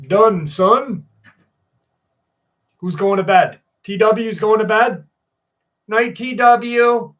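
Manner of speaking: called out loud to a chatter; big loud hello to chat; loud greeting. Real, not acted. Streamer calling out to a viewer going to bed, loudly.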